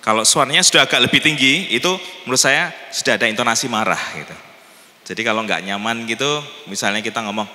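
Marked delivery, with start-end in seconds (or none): none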